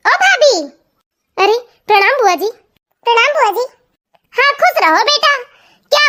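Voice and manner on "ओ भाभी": calling out again. Acted.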